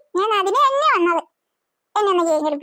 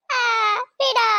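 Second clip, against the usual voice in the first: normal talking voice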